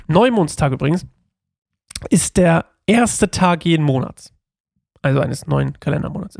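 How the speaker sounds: normal talking voice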